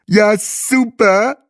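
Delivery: high, loud, drawn-out mouth-full cheer. A narrator's reading. Bahe applauds the estate agent, high and slow through food. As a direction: gush slowly through a mouthful. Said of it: "sagte Bahe mit vollem Mund".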